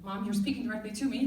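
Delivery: ordinary delivery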